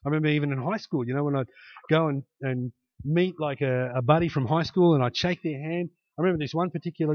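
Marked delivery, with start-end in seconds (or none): none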